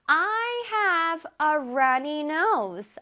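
very slow, careful English; slow teacher demo; slow model reading. Shown as a demonstration. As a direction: read each word slowly for students. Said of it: "문장에서 보자".

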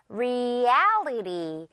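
Reality with the "al" drawn out like a rubber band; stretched middle syllable. Shown as a demonstration. Teacher demonstrating to learners, Reality with a long, stressed "al". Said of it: "L 부분을 아주 길게 입을 늘어뜨려서".

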